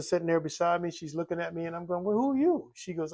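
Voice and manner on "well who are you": surprised question. Retold or imitated. Waking beside a stranger.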